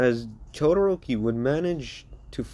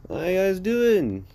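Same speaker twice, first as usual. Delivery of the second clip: higher, slower, stretched-out hello to the class; a drawn-out, teasing welcome to the freshmen; cheery Gojo greeting; 8 semitones higher, slower than usual. Acted. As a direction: greet them like a cocky cool teacher.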